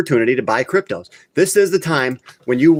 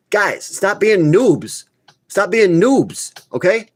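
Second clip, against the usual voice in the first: slow, higher put-on scolding voice; mock scolding; 8 semitones higher, much slower than usual. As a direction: drag it out like a mock lecture to noobs. Scolding viewers over crypto picks.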